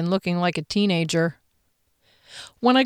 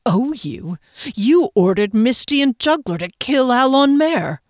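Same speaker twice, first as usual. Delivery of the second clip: starts contained, then rising higher and louder in fury; quiet rage; 5 semitones higher, 4 dB louder than usual. A narrator's reading. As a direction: accusation that builds. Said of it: "her voice was not much louder than the bird, but ... rage".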